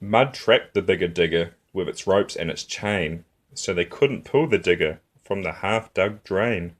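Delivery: ordinary delivery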